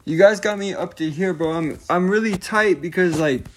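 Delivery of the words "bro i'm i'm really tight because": ordinary delivery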